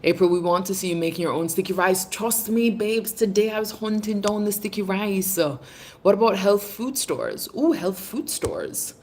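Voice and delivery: ordinary delivery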